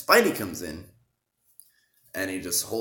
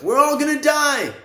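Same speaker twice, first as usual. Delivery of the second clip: much higher, much louder terrified shriek; hammed-up high panicked shriek; panicked scream; 12 dB louder than usual. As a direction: scream it like a kid on the bus who thinks it's the end. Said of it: "Ned's like".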